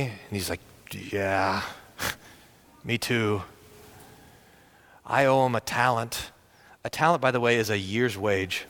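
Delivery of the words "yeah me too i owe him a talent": rueful laugh. Acted. Fellow debtor, rueful chuckle.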